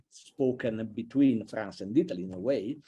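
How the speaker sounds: ordinary talk, nothing marked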